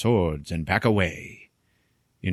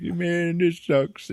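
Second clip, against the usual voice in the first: mock crying; 10 semitones higher, much slower than usual. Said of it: "he starts crying again".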